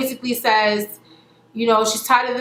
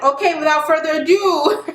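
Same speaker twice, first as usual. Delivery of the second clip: laughing while quoting; 6 semitones higher, 3 dB louder than usual. Retold or imitated. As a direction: giggle through the quote. Ray J retold, laughing.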